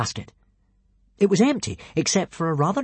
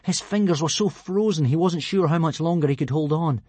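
ordinary delivery, nothing marked

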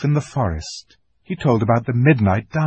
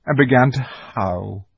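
plain reading voice

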